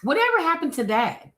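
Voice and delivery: incredulous repeat. Real, not acted. Repeats the question, pitched way up.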